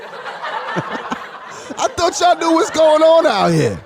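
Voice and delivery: high, drawn-out incredulous wail through laughter; laughing disbelief. Real, not acted. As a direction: protest while cracking up. Amused disbelief at audience answers.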